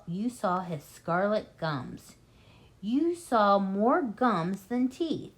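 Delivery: plain reading voice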